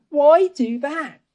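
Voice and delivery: high, slow, wondering why do that; puzzled voice. Acted. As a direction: ask it as a baffled onlooker. Voicing people's doubt in story.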